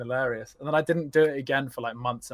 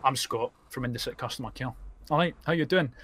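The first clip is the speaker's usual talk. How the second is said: Scottish call-centre agent persona; Scottish accent. Acted. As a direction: introduce yourself as a Scottish customer care agent. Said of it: "put on a Scottish accent".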